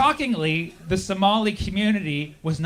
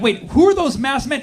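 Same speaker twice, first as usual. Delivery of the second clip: surprised; 7 semitones higher, 6 dB louder than usual. Retold or imitated. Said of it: "you're like".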